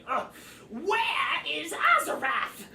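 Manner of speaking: high, loud, drawn-out goblin grumble; exasperated goblin. Acted. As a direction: holler it as a fed-up goblin. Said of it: "and the goblin".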